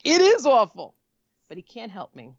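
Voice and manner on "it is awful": loud, raised retold retort; outraged agreement. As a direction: throw it back with indignant force. Her outraged echo of the agent.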